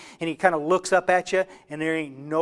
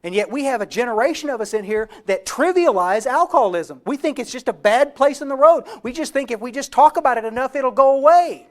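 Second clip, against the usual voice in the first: normal talking voice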